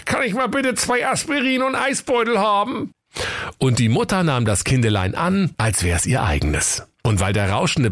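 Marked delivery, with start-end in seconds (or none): 0.0-2.9 much higher, whining in pain; whiny high husband. Acted. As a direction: squeal it like a hurt husband. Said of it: "ihr Mann sprach".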